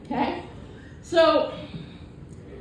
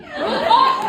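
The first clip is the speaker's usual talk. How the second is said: much higher, delighted shout; triumphant glee; 4 dB louder than usual. Real, not acted. Comic gloating over sweet revenge. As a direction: crow with glee.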